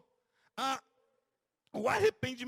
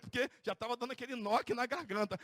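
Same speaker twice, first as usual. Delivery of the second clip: ordinary talk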